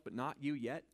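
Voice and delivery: usual speaking voice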